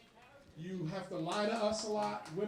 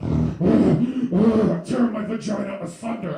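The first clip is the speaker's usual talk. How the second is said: much louder possessed scream; mock demonic scream; 15 dB louder, slower than usual. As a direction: shriek it like a possessed woman. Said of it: "she screamed out".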